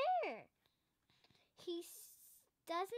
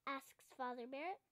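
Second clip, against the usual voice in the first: ordinary delivery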